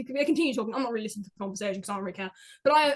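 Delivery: normal talking voice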